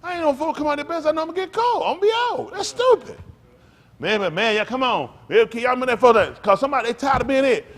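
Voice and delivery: excited storytelling. Real, not acted. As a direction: relive the game with rising excitement.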